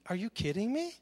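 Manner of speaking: dry, sarcastic "are you kidding me"; mock God's incredulity lightly; an understated sarcastic jab; sarcastic disbelief. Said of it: "the sarcasm in here".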